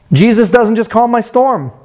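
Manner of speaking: ordinary delivery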